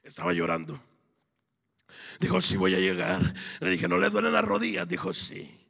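high, loud shouted testimony; full-force preaching. Acted. Preacher retelling a crawling pilgrim's faith to the congregation, full-force shouted preaching. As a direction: build to a roaring cry at the end.

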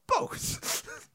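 very high, unvoiced squeak; guilty squeaky answer; sheepish admission. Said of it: "it's like".

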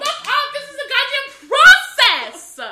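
playful loud protest; mock-indignant yell. Real, not acted. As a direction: shout them down in playful outrage. Teller snaps back at teasing.

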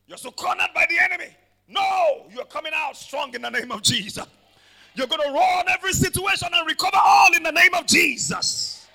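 loud, high, fast shouting sermon; full-force preaching. Acted. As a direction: rouse them out of the corner.

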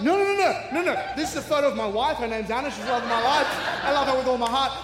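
high, loud, frantic and laughing; flustered laughing denial. Retold or imitated. Frantically reassuring the clerk he is married, laughing. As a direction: backpedal in a panic, laughing.

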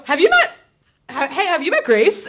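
bright, high, loud "have you met"; mock cheery introduction. Friend acting out introducing her pal, cheery and loud. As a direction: play the overeager matchmaker.

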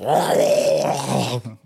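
loud drawn-out strangled yell; imitated choked yell. Choked-out opponent responds to tap-out demand, imitated yell. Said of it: "his response was".

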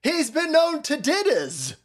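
high, slow parody of slangy speech; mock his way of talking, stretched out; exaggerated mimicry of a rapper; mocking rapper impression. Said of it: "he don't talk like that".

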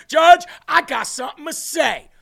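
loud high mocking yell, imitating her; hammed-up outburst; mock shout at the judge. Mocking her bursting in on the judge, loud high yell. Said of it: "ran down there ... and said".

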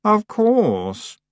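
high, self-satisfied witch; smug. A narrator's reading. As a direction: say it smugly. Anagrama smug.

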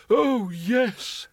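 put-on dreamy, high old man's sigh; lost in wonder. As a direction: breathe it out in awe. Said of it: "lost in wonder".

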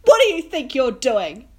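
yelling across the dorm at a man being sick; retold yell. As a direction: confront him loudly. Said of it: "And I say".

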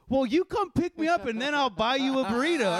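high-pitched mock bargaining; stubborn counter-argument. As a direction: imitate a friend obtusely arguing back. Retelling friend's stubborn counteroffer.

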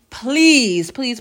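normal talking voice

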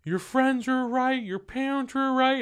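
very high, drawn-out mock accusation; girlfriend going off. Girlfriend throwing his critics at him, high mock rant. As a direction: imitate her going off at him. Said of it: "Just going off".

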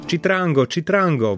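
calling out. A narrator's reading. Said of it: "wołał Gawron". (nawoływanie)

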